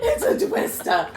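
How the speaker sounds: excited Brooklyn Dorothy imitation; squealed, high and excited. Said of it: "I love how, how excited you are".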